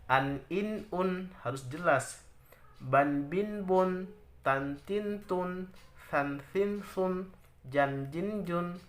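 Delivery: each syllable short, pressed and quick; short crisp syllables. Shown as a demonstration. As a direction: tap out each sound briskly. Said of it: "ditekan dan cepat dan pendek".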